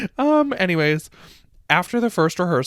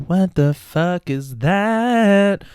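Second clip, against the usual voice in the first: mock spooked; 5 semitones higher, much slower than usual. Kids find tunnels under stage.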